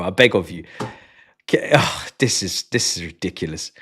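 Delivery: ordinary talk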